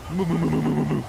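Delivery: loud high repeated moos; cow mooing. Pretend. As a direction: moo like a cartoon cow, loudly. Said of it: "you're just like".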